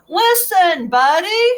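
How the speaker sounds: loud call of his name; yelling. Acted. Parents yelling to get Buddy to listen, loud. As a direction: yell it to get his attention. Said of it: "His parents tried yelling".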